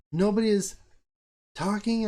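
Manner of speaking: high mimicry of the wife; wife insisting nobody is talking; mock wife voice. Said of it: "she's like".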